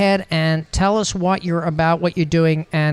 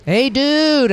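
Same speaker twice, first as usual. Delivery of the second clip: excited greeting; 9 semitones higher, 3 dB louder than usual. Real, not acted. Greeting guest joining by phone.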